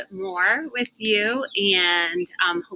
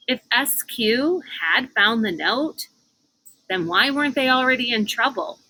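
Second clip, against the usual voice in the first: ordinary delivery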